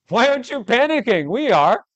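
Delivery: high, loud frantic cry; panicked. Acted. Terrified disciples demanding Jesus panic, high and loud. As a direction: shout it in full panic. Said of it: "This is time to panic".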